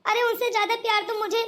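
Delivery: ordinary talk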